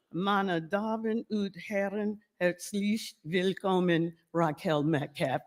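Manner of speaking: carefully retry the German welcome; a hint of German host voice; attempted German. Shown as a demonstration. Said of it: "But wait, I'm gonna do it".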